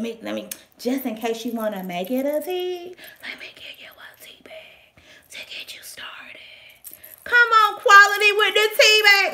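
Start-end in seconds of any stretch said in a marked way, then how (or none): 3.2-6.4 unvoiced whispered aside. Vlogger voices the seller slipping in a tea bag, whispering. 7.2-9.3 higher and louder hype; excited cheer. Real, not acted. Vlogger praises the included tea bag, excited cheer.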